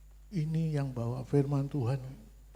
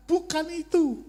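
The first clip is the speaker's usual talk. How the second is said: high, firm divine refusal; mock God cutting him off; 14 semitones higher, 6 dB louder than usual. (suara Tuhan tinggi, menolak dengan tegas)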